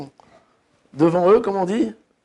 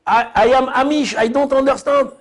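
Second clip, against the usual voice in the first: higher English; 6 semitones higher than usual. Retold or imitated. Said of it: "Pareil en noir et blanc avec le chapeau".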